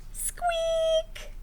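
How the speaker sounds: tiny squeaky mouse sound; high mouse squeak. Acted. Mouse noise in story.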